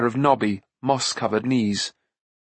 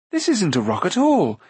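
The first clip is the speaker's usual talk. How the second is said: higher-pitched puzzled discovery; surprised; 6 semitones higher than usual. A narrator's reading. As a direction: exclaim it in surprise to companions. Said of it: "He turned in surprise".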